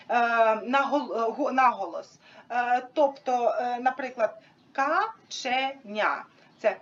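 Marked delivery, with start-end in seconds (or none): 4.7-6.2 КА-ЧЕ-НЯ in three slow, separate beats; slow «каченя», a pause between syllables. Shown as a demonstration. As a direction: break «каченя» evenly into ка, че, ня. Shows syllables before stress demo.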